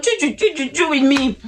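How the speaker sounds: high, quick "choo, choo" mimicking nonstop nagging; mock chatter noise. Mocking her chattering back.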